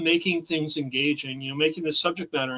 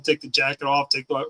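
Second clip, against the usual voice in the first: ordinary delivery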